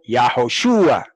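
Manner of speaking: Yahoshua said slowly with the accent on the U; stress on U. Shown as a demonstration. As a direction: lean on SHU in Yahoshua, stretching it out. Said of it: "El acento es la U".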